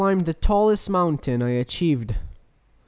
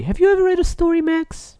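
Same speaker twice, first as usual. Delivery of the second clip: high, louder sing-song question; squeaky host voice; 11 semitones higher, 4 dB louder than usual. Acted. Host asks his co-host in a squeaky voice. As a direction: chirp it up at him. Squeaky persona addressing Max.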